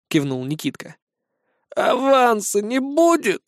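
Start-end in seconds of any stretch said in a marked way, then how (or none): 1.8-3.4 high slow whiny ghoul voice; grimacing ghoul. A narrator's reading. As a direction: sour and slow, like a stingy ghoul. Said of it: "скривился упырь".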